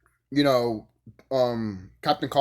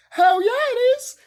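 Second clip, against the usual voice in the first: much higher, loud squealing hell yeah; excited cheer; 5 dB louder than usual. Real, not acted. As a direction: squeal it, totally overjoyed. Fan cheering the love story.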